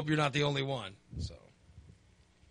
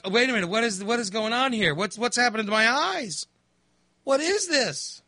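higher-pitched, bewildered questioning; baffled disbelief; 7 semitones higher, 3 dB louder than usual. Retold or imitated. Fan retelling his surprise at his own tears, bewildered. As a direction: act out growing alarm at your own body.

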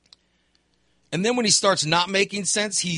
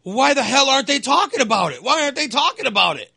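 higher, louder mock rant; mock Trump complaint; 7 semitones higher, 6 dB louder than usual. Trump complaining about the media, loud mock rant. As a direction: rant like the aggrieved candidate. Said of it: "you hear Trump going".

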